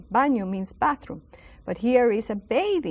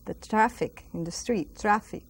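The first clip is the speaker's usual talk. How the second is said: normal talking voice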